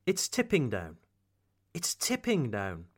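accent lands on "tipping", falling after it; stress on tipping. Shown as a demonstration. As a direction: lean on "tipping", let "down" drop. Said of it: "I accentuate the word tipping".

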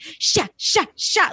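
short, sharp, high jabs of sound; razor-blade words. Retold or imitated. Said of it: "her words are like ... razor blades".

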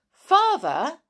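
high, loud call to Father. Acted. Girl calling her motionless father in the cave, calling out. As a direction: call out to get his attention.

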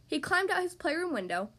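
ordinary delivery

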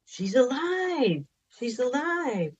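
waking husband with joyful, high-pitched news; overjoyed disbelief. Retold or imitated. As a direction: blurt it out, pitched high with wonder. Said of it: "And I'm like".

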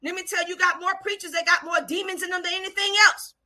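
high, urgent altar-call preaching. Real, not acted. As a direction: call them forward with rising questions.

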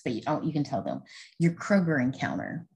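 usual speaking voice

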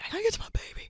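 high, breathy panicked whisper; frantic whisper. Retold or imitated. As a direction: whisper it like a desperate mom. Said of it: "as a mom, you know, you're just like".

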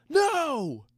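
very high, mock crowd yell. A narrator's reading. Mages answer their leader, high yell at modest volume. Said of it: "yelled the mages".

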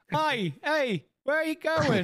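high-pitched mock voice; hammed-up high character call; teasing orc imitation. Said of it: "He's like".

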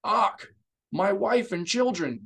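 high, loud, desperate outcry; pleading in horror for his family; anguished shout to his aide; panicked cry. A narrator's reading. Senator realises family was inside.

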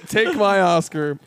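laughing. Retold or imitated. Joking as Cumberbatch.

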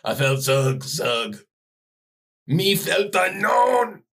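caveman voice building to a slow shout. Pretend. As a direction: grunt then bellow like a caveman. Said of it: "keep this up 'cause it's funny".

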